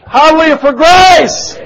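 very loud, high cry of praise; rally the congregation with a shouted praise; jubilant shout. Real, not acted. Preacher exclaims praise.

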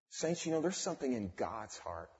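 ordinary delivery

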